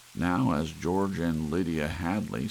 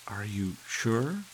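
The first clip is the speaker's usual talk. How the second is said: higher, slow, uneasy wife; tense; 6 semitones higher than usual. A narrator's reading. Wife uneasily questioning husband, tense and slow. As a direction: ask it uneasily, held back. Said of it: "His wife sounded peculiarly tense".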